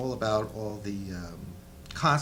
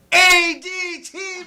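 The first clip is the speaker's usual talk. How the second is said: much higher and louder, hyped announcement; excited plug; 15 dB louder, slower than usual. Pretend. Actor hyping his project to the host, excited shout. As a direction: shout the title like an ad. Plugging the show loudly.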